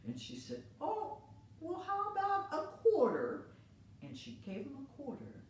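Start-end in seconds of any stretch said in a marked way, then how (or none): 0.8-3.5 put-on very high, slow old lady voice; sweet old lady. Old lady thanks the boy, very high and sweet. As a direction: coo at the helpful boy.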